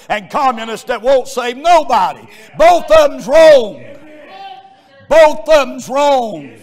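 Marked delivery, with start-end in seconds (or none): 2.6-3.8 loud, slow shout on wrong; shouted verdict. Acted. Preacher shouting down both sides, slow and loud. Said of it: "repeated for emphasis". 5.1-6.6 high, loud shouted preaching; preaching at full force. Acted. Preacher shouting God's love to the congregation.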